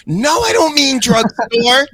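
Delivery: high, loud, drawn-out objection; exasperated retort. Acted. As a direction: snap back at a stupid suggestion. Arguing back at search suggestion.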